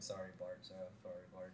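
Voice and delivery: very quiet, low muttering; acted under-the-breath crowd apology; mumbled apology. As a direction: murmur grudging sorries as you leave. Acting a departing crowd's mumbled apologies.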